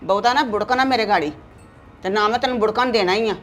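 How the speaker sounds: loud stubborn telling-off; mother scolding her son at full voice; angry scolding. Acted. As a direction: put the son in his place.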